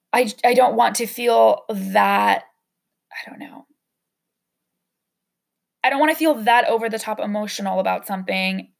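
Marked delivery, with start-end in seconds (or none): 3.1-3.7 barely whispered, trailing off; an unvoiced whisper of resignation; whispered aside. Real, not acted. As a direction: whisper it to yourself, giving up the thought. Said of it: "real whisper, breaking off".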